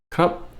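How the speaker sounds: krup said high and short. Shown as a demonstration. Teacher showing the high male polite particle. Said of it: "polite particle at the end".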